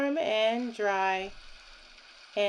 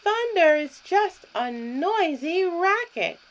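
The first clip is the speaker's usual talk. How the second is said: louder, higher duck shout; loud quack; 7 semitones higher, 8 dB louder than usual. Acted. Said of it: "loud as he could quack it".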